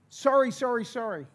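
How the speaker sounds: high yelped apology, tailing off; mock panicked apology. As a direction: beg for mercy in a hammed-up cry. Acted plea while being hit.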